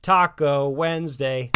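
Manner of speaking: loud, drawn-out call; dad repeating his taco night announcement; shouting. Acted. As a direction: boom it out happily. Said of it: "Ron kept shouting".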